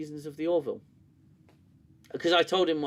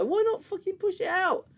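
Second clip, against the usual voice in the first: high, indignant demand; exasperated rant. Real, not acted. Ranting that reviewers won't promote the show, exasperated. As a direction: push the question out in frustration.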